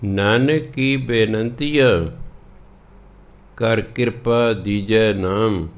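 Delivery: slow, measured Gurbani reading; scripture recitation. Acted. Teacher reading the shabad to learners, slow recitation. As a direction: recite each line slowly, drawing out the words.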